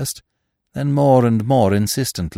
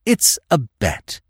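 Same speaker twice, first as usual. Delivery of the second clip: slow, deliberate agreement; weighty, fervent pledge; intense; 3 semitones higher, slower than usual. A narrator's reading. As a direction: seal the deal with slow intensity. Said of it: "with equal intensity".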